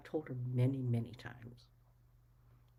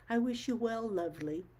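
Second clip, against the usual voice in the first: higher and louder sing-song sign-off; mock condescending farewell; 6 semitones higher, 5 dB louder than usual. Imitating the woman's voice message sign-off, mock condescending. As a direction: throw her patronising goodbye back in mimicry.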